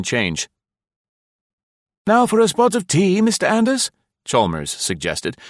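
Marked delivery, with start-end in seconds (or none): 2.1-3.9 eager host. A narrator's reading. Eager host offers a drink.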